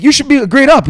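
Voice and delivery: high, loud imitated kid; mocking kid. Gamer kid taunting a thirteen-year-old, mock scornful. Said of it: "they sound young".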